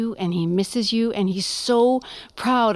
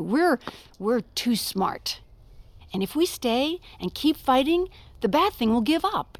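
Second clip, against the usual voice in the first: ordinary talk, nothing marked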